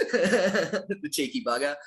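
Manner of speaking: slow words through a laugh. Real, not acted. Laughing at the Aussie joke.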